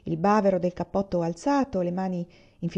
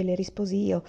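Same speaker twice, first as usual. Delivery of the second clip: plain reading voice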